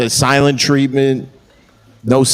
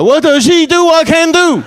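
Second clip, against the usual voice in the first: shrill jealous wife. Retold or imitated. Comic voicing a jealous wife, very high in mock accent. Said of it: "She was like".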